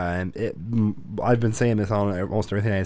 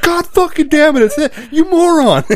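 screaming; 10 dB louder than usual. Retold or imitated. Imagined brother yelling at the podcast on his phone, screaming. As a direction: imitate someone screaming at a phone. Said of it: "your brother is screaming at his phone".

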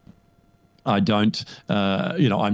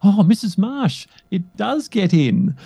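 put-on child in an ad; 8 semitones higher than usual. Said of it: "and the child goes".

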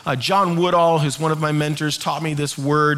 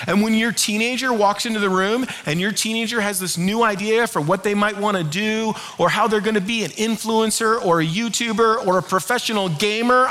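normal talking voice

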